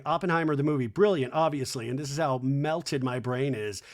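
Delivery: normal talking voice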